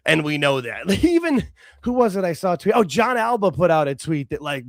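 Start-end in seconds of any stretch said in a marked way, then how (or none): none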